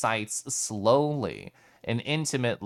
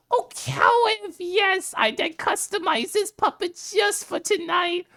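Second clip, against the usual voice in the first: a light cartoonish imitation of a friend; hint of Robikin's voice. Streamer imitating friend Robikin's voice for chat, high and cartoonish. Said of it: "I'm not gonna mimic it fully, but just a little hint".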